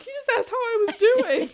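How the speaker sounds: high, loud words through a sob-laugh; squeezed out through tears; teary high quote; laugh-crying quote. Retold or imitated. Her own reply while sobbing.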